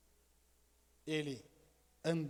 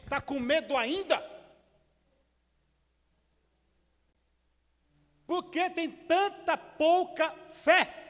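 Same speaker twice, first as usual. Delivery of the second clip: high, louder, slow rebuke; rebuking Jesus; 10 semitones higher, 5 dB louder, slower than usual. Shown as a demonstration. Jesus to his frightened disciples in the storm, a loud slow rebuke. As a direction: scold the frightened disciples firmly. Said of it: "Mudou a entonação de voz".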